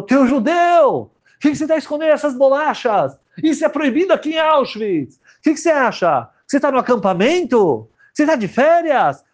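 guard snarling at a hidden ration; angry Nazi guard. Acted. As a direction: berate the prisoner with contempt. Nazi shouts at prisoner.

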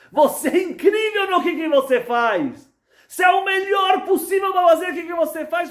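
high, loud shout of praise; doctor exults to the counselor, shouting; ecstatic praise. Acted. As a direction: proclaim it with overflowing joy.

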